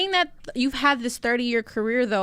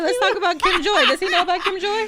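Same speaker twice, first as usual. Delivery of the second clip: excited; 7 semitones higher, 4 dB louder than usual. Real, not acted. Teasing, excited about Kim Joy.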